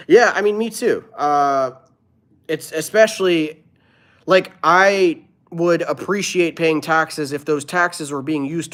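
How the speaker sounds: loud, high, fired-up rant; impassioned tax rant; heated rant. Real, not acted. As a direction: push it out, getting worked up. Ranting about taxes, raised voice.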